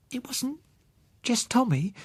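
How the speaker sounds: put-on high child voice, tearful; a small girl on the edge of crying; little girl. Child Susan voiced in story.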